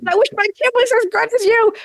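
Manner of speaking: very high, put-on admiring squeal of words; gushing parent. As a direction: imitate an overexcited parent in a high voice. Voicing parents gushing after the show.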